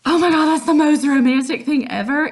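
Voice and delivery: loud, high gush; gushing Juliet. Retold or imitated. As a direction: squeal it out thrilled. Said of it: "Juliet's like".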